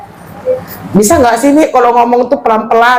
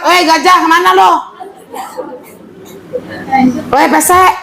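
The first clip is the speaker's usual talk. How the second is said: mock insult; 7 semitones higher than usual. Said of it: "mencaci fisiknya".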